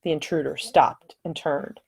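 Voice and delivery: ordinary delivery, nothing marked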